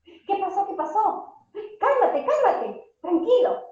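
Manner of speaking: parent rushing to calm. Retold or imitated. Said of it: "Qué es lo primero que se nos ocurre y decimos".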